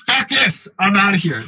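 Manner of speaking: high-pitched exclamation, dropping after the start; mock alarmed exit. Disgusted person in the crowd bailing out, high mock alarm. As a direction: imitate someone bailing out fast.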